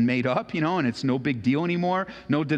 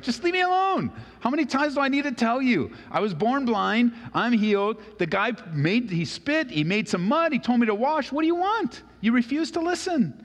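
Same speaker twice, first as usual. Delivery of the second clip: exasperated, much higher and pushed; fed up, snapping back at the questioners; high, frustrated outburst; 9 semitones higher than usual. Retold or imitated. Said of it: "he's getting a little frustrated".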